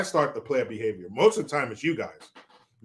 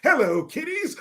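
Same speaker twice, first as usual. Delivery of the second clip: high ghoulish greeting, much higher; giggly corpse host voice; Crypt Keeper impression; 13 semitones higher than usual. Streamer claims Biden would greet like this, high ghoul voice. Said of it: "Biden would say".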